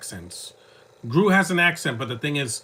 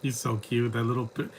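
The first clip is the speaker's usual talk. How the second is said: ordinary talk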